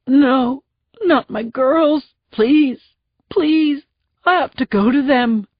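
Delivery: high, tearful, begging; crying plea. Acted. Husband begging the sergeant to let him through, crying. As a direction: plead to be let go to them. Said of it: "Marone cried".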